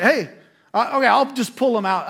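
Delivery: opposing coach offering loudly to pull pitcher. Retold or imitated. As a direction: call out the offer, loud. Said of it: "He's like".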